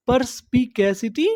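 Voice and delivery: "परस्पिकैसिटी" in measured pieces, ending on a rise; broken into syllables. Shown as a demonstration. Teacher prompting learners with the word, syllable by syllable. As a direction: say "परस्पिकैसिटी" part by part, pitch lifting at the end. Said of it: "टुकड़ों में तोड़-तोड़ के पढ़ना है".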